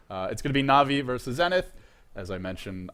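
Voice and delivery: usual speaking voice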